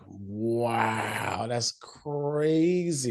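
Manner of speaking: long drawn-out wow, climbing in pitch; slow, stretched-out disbelief; amazed. Retold or imitated. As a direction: stretch every word in mock astonishment. Acting out own amazed reaction.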